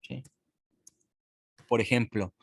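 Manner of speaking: normal talking voice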